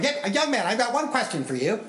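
a hint of a pointed little old lady. Old lady questioning him in line, lightly voiced. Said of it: "this little old lady in a walker".

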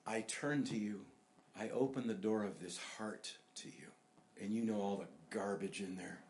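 soft, unhurried prayer for others to follow; humbly surrender in hushed prayer; sinner's prayer. Acted. Leads a model prayer of surrender.

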